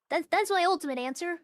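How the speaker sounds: usual speaking voice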